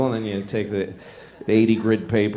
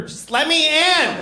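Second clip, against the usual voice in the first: high-pitched caricature, drawn-out plea; mock high-pitched border pleading; Mexican at the border; slower than usual. Comic plays a Mexican pleading at the border, high caricature voice. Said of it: "this is a Mexican at the border".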